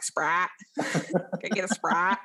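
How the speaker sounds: hillbilly "Sprite" with the long I flattened to "ah"; amused imitation of a hillbilly accent saying "Sprite". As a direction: say "Sprite" as "Spraht", hillbilly style. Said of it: "I've got a hillbilly accent".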